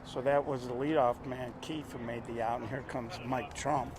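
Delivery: hushed golf announcer voice. Acted. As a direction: keep it soft like at the golf. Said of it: "I'm gonna go to my golf announcer voice".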